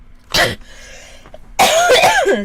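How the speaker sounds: sudden loud coughing fit; real cough. Real, not acted. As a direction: cough hard, off mic.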